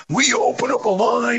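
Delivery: high, strained old man impression. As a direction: squeak it out like a cranky old man. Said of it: "the old man ... he goes".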